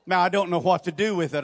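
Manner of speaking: usual speaking voice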